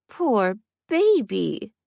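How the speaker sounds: high, very drawn-out cooing; exaggerated, teasing sympathy; mock pity. A narrator's reading. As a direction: coo over him like an overgrown baby.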